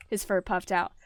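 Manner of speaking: ordinary delivery, nothing marked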